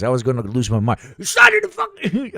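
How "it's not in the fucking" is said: imitating himself, outraged. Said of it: "I was like".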